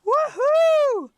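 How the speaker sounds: high, loud whoop; joyful scream. Acted. Sid ducks under a big wave, loud joyful whoop.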